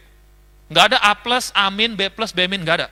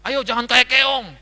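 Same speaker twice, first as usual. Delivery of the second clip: impatient boss; 6 semitones higher than usual. Retold or imitated. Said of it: "nggak suka yang lambat-lambat".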